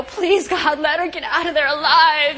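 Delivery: lightly dramatised prayer for a rescue; a touch of hammed-up pleading; mock praying crowd. Imagined crowd praying for her rescue.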